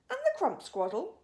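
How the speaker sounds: high, loud eager question; excited question. A narrator's reading. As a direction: push it up with glee. BFG listing creatures.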